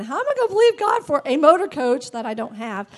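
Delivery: usual speaking voice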